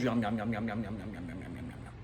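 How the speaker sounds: repeated yums fading to very soft and low; playful eating noises sinking into a murmur; mock munching. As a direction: gobble it, then trail away. Pretends to gobble the sandwiches.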